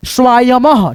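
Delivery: स्वायमः slow, ending in an audible h; slow classroom स्वायमः with the final ह; visarga pronounced. Shown as a demonstration. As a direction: spell out स्वायमः slowly for students, final h breathed. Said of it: "विसर्ग का उच्चारण ह के रूप में".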